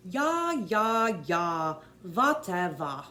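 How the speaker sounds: loud, slow, hammed-up German accent; a bad German accent, loud and dismissive; terrible German accent. Shown as a demonstration. Coach shows the psychiatrist's line in a loud German accent. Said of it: "horrible job on a German accent".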